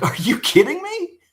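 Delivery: high, loud, incredulous outburst; appalled at the price; retold disbelief. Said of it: "I'm like".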